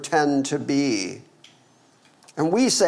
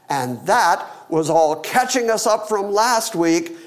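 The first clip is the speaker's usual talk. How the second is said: ordinary delivery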